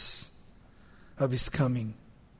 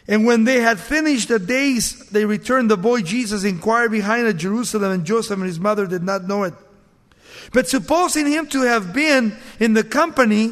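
raised, ringing Bible reading; preacher reading scripture, a little higher and louder; 3 semitones higher, 4 dB louder than usual. Acted. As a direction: read the passage out to the congregation. Reads scripture aloud.

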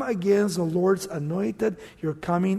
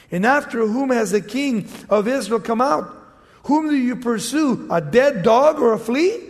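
raised, emphatic Bible reading; scripture reading; 3 semitones higher, 4 dB louder than usual. Acted. Preacher reads scripture to congregation, emphatic and raised. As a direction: read the verse out to the congregation.

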